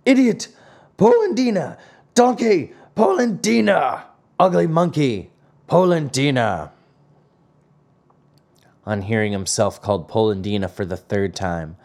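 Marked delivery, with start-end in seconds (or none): 0.0-6.7 trading insults. A narrator's reading. Two old men call each other names, high acted anger.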